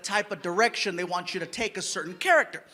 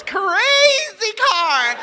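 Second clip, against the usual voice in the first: much higher, louder and slow; crazy car; 10 semitones higher, 7 dB louder, much slower than usual. Retold or imitated. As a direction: stretch it out like a madman. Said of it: "She's like".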